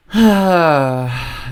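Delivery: loud, long exhaled sigh; heavy sigh. Real, not acted. Streamer recalling a disappointing campaign moment, heavy sigh. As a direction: let out a big, audible sigh. Dismayed after recalling her Palestine remark.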